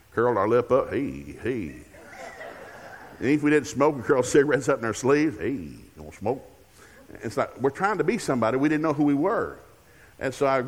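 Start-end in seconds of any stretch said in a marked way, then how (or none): 0.9-1.8 low, cool-guy drawn greeting. Acted. Kid trying to be somebody, imitating a cool singer. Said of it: "wore our hair like [name]". 5.4-6.4 low, slow cool-guy voice. Acted. Non-smoking kid posing with cigarettes, mock cool. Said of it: "wore our hair like [name]".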